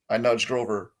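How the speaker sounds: plain reading voice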